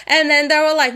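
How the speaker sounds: ordinary talk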